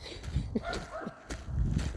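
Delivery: quiet breathy laugh; soft giggle. Real, not acted. Laughs at own slip.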